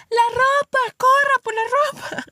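high, loud mock mother's shout; mom yelling about laundry. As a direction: call the kids to run for the washing. Mom calling out: rain, laundry.

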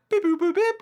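high, loud chirpy robot beeping; robot beeps. Retold or imitated. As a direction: beep it out like a cartoon robot. Imitating robot noises.

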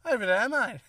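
loud mock shout. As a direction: bellow it like a cheeky driver. Loud acted quote, cop and driver.

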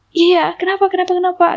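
high, repeated startled question; retold flustered reply; alarmed questioning. Startled passenger answering the driver, high and urgent.

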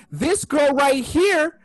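high, loud, drawn-out tattling; retold loud accusation. Kid showing mom who said shut up, high loud accusing re-enactment. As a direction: re-enact a kid calling someone out, slow and loud. Retold child pointing out offender.

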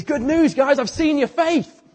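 high, loud and slow; joyful good news. Acted. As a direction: announce it up to the roof with delight. Jesus calls up to the roof.

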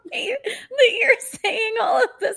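real laughter she can't hold back; helpless laughter. Real, not acted. Cohost reacting to the date story, laughing hard.

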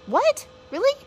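high, loud surprised retold "What? Really?". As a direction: jump up in pitch like hearing big news. Mom retells kids' approval.

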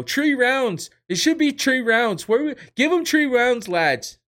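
high, loud mock Irish shouting; bad Irish accent, yelling it over and over; hammed-up Irish rant; horrible Conor impression. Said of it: "that's a horrible, that's a horrible impression".

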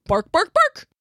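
high yappy barks; dog barking. A narrator's reading. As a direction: bark it out like a dog. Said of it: "all the human heard was".

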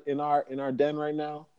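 normal talking voice

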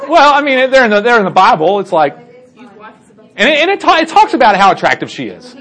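high-pitched, half-laughing protest; flustered self-defense. Real, not acted. Defends own risqué joke after laughter.

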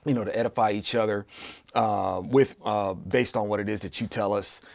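soft, earnest prayer voice. Acted. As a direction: lead the group in quiet prayer. Leads an opening prayer.

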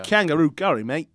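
a hammed-up but weak Australian accent; playful attempt at an Australian accent; mock Australian accent. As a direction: push a jokey Australian accent on the punchline. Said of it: "your attempt of an Australian accent".

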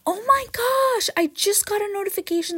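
high-pitched surprised gasp of words; shocked outburst. Real, not acted. Podcaster interrupted by a bankruptcy notification, shocked.

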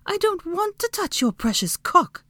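high-pitched, indignant female character voice; snippy retort. A narrator's reading. Hermione answering Draco's demand, snippy. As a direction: snip back, pitched right up. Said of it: "she snipped back".

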